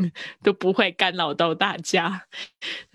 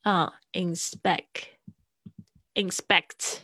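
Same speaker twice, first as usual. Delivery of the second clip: inspect drawn out very slowly, quietly; slow syllable demo; 4 dB quieter, much slower than usual. Shown as a demonstration. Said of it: "Inspection是这个inspect这个字来的". (把inspect一个音一个音慢慢念)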